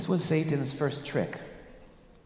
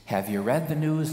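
ordinary talk, nothing marked

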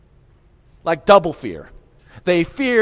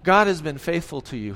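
ordinary talk

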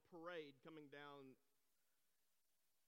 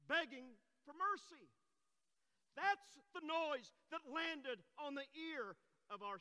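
high, loud, slow proclamation; full-force preaching; 10 semitones higher, 12 dB louder, slower than usual. Acted. Preacher telling congregation of a sinner begging mercy, full-force preaching. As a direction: hammer out each phrase with fervor.

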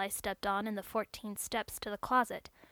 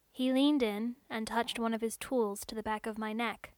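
usual speaking voice

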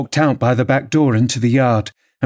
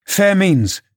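higher-pitched, pointed declaration; pointed challenge; 8 semitones higher than usual. A narrator's reading. Wife to her startled husband, pointed challenge. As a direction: throw it down as a challenge.

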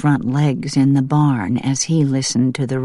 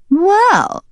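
very high, drawn-out well; gracious duck leader; 5 dB louder than usual. Acted. Duck leader beginning a gracious reply, very high. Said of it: "in a gracious voice".

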